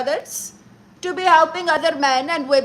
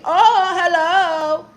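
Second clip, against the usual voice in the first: laughing drawn-out hello; 4 semitones higher, 4 dB louder, much slower than usual. Acted. Flirty greeting to a chatter.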